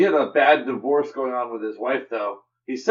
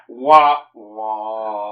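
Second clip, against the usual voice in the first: loud wah, then a lower one; mock sad trombone; 5 semitones lower, 5 dB louder than usual. Shown as a demonstration. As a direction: imitate a falling sad-trombone sting. Sad-trombone noise after a bad joke.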